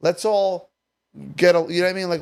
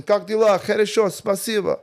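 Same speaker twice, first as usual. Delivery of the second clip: a louder, higher voice playing a Russian guy; 6 semitones higher, 4 dB louder than usual. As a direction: play the Russian guy greeting you, a step up and louder. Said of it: "Some Russian guy comes in".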